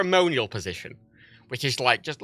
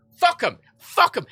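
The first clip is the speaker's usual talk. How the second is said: high, punchy mock contempt; scornful outburst; 12 semitones higher, 5 dB louder, slower than usual. Voicing Britain's contempt for struggling voters, scornful shout. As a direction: throw it out loud and high, bitterly sarcastic. Sarcastic outrage at Britain's attitude.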